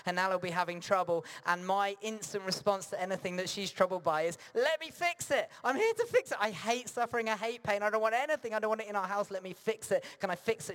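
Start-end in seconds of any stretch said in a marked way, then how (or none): 4.5-10.7 much higher, louder and urgent; frantic fixer. Retold or imitated. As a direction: play his frantic eagerness to fix everything. Preacher voices his urge to fix.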